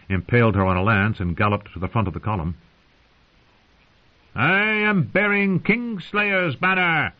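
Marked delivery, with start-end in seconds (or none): 4.3-7.1 high, slow mocking shout; shouted taunt. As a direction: yell it out to jeer the prisoner. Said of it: "he shouted".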